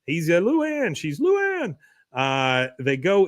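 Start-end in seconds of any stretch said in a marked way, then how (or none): 0.4-0.9 high-pitched chasing call; mock desperate call after her; Tripp calling out. Tripp calls while chasing Luann. 1.2-1.8 very high, drawn-up mock call; squeaky-high imitation of a woman calling; Peggy calling out. Peggy calls, running behind him.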